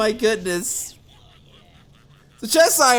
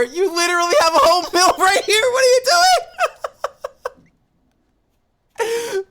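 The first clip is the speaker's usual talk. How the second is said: high, fast, laughing, rising to a yelp; incredulous outburst; 10 semitones higher, 4 dB louder, faster than usual. Real, not acted. Caster disbelieving a player ignoring the mill beside him. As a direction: splutter at the obvious miss.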